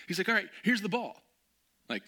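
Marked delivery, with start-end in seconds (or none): 0.3-1.2 high, loud eager asking; eager question. Retold or imitated. Friend eagerly asking to shoot, high and louder. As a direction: ask eagerly.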